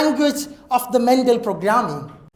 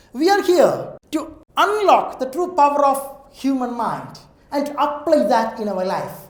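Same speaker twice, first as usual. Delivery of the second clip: ordinary talk, nothing marked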